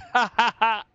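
high, quick burst of laughter; gleeful trash-talk laugh; gloating laugh. Real, not acted. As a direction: cackle at the opponent.

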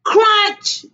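high, loud storybook CRUNCH; sudden high-pitched sound-effect word. A narrator's reading. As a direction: punch the bite sound out loud. Big bite in the story.

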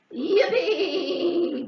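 high, loud braying cheer; brayed yippee. Acted. Goat cheering at the offer, loud high bray. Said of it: "brayed Goat".

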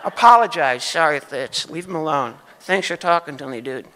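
ordinary talk

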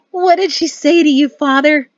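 high, loud, delighted squeal; gleeful, high-pitched outburst; excited squeal. A narrator's reading. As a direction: squeal the question eagerly. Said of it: "clapped her hands and squealed".

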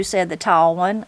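ordinary delivery

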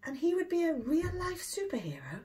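breathless whispered wonder; awed whisper. A narrator's reading. Narrator voicing the boy's amazed thought in a whisper. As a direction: whisper it like a secret too good to be true.

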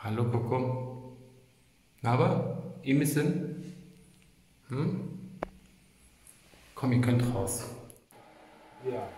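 soft, quiet cooing to pets. Real, not acted.

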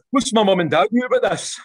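high, loud question through a laugh; laughing pupil. Retold or imitated. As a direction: laugh as the pupil asks about parents. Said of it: "turn and say".

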